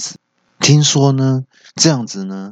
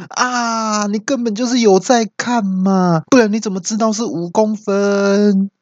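mock outcry; 12 semitones higher than usual. Friend accusing the uncle of peeking, crying out. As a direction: cry out in outrage without real volume. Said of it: "听到就大叫说".

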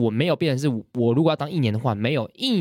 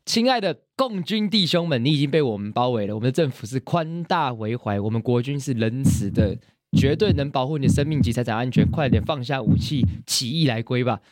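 propaganda broadcast. Shown as a demonstration. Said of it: "心战喊话员。".

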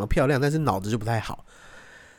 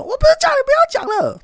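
high, loud and fast, much higher; mock shrieking girlfriend; 6 dB louder, faster than usual. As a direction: yell at him in the street. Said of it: "就在街上大吼". (模仿女友在街上尖叫)

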